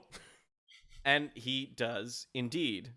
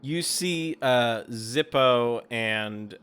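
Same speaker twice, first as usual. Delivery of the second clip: normal talking voice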